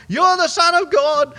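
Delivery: high, loud, strange shriek; demons shouting. Acted. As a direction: cry it out like fleeing demons. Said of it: "they were shouting".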